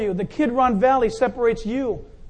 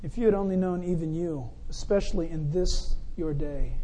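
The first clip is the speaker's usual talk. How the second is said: grieving lament; 5 semitones lower, 6 dB quieter than usual. Acted. Jesus weeping over city.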